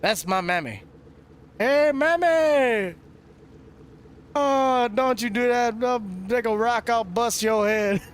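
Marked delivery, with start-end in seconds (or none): 1.6-2.9 high, stretched-out holler of mammy; calling out. Pretend. Calling to his mammy in a high, drawn-out holler. Calls out to mama in character. 4.3-8.0 high, scolding mama voice; mock mama threat.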